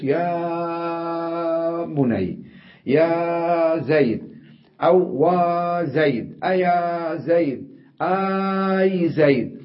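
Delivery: long calling vowels, slow and high; drawn-out calling. Shown as a demonstration. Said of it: "لأنها تساعد على مد الصوت". (نداء ممدود الصوت كأنه ينادي بعيدًا)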